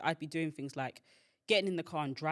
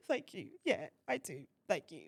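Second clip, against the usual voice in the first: small mock-weepy reply; imitated tearfulness. Daughter answering mum's food offer, mock-tearful. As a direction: act out welling up over a kind offer. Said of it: "I would cry over anything".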